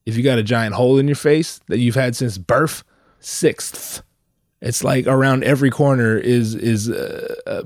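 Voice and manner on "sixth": "sixth" breathed out unvoiced, each S and TH hissed through a gap tooth; careful sixth. Shown as a demonstration. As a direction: whisper "sixth" slowly, lisping the final TH. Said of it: "to say the word sixth".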